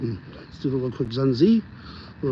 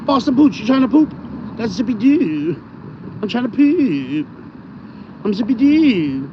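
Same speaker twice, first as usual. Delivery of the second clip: higher, drawn-out doggy voice; talking for dog; 6 semitones higher, slower than usual. Acted. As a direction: speak as the poodle, stretching the last word. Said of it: "So it's like I'm talking for him".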